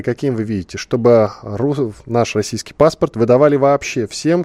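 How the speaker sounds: ordinary talk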